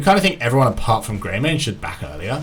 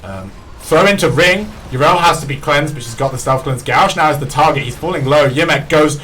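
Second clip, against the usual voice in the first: louder, higher fight casting; excited play-by-play; 7 semitones higher, 10 dB louder than usual. Acted. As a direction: call every kill with rising hype. Hype play-by-play of a teamfight.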